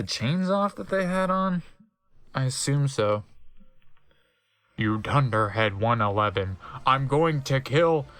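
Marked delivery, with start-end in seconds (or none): 4.8-8.1 low Snape threat. Acted. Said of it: "shooted Snape".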